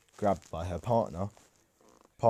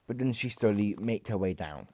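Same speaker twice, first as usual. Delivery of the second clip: plain reading voice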